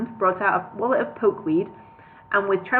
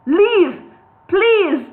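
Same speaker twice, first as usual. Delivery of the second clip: high loud screech; 10 semitones higher, 8 dB louder than usual. Acted. Hobbit screeches at the wizard to leave. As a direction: screech the plea. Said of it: "screeched Bingo".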